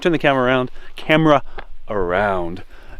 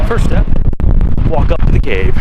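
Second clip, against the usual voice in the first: cave echo; 2 semitones higher, 10 dB louder than usual. Real, not acted. Shouting outdoors on the climb.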